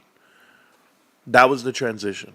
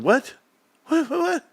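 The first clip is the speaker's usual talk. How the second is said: high-pitched incredulous stammer; hammed-up, baffled dad; mock disbelief. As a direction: squeak out stunned repeats, rising. Retold disbelief at being refused.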